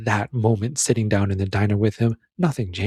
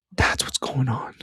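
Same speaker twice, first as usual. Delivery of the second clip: whispered. Real, not acted. Reviewer whispering his takeaway to viewers. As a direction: lean in and whisper it. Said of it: "whispered conclusion".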